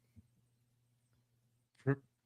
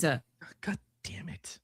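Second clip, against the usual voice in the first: whisper; 10 dB quieter, much slower than usual. Real, not acted. Embarrassed after wrong accent.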